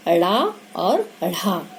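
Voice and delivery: ड़ा और ढ़ा said very slowly with the tongue flap; careful flap sounds. Shown as a demonstration. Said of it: "इनके भी उच्चारण में लोग गलतियां". (ड़ा और ढ़ा का धीमा उच्चारण)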